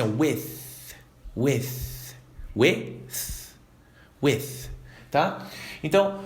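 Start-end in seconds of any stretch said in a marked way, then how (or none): 0.0-4.7 with repeated slowly, ending on a clear th; slow th drill. Shown as a demonstration. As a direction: drill the th in with, one word at a time. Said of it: "aqui você tem que fazer assim".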